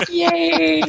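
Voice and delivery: high, giddy cheer; excited squeal. Real, not acted. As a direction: squeal with real delight. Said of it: "One of us is serious".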